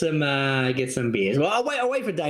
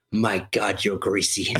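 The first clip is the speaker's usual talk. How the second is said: much lower, deadpan quoted line; Simpsons line quote; 12 semitones lower, slower than usual. Retold or imitated. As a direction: drop low for the Simpsons line. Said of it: "that Simpsons line".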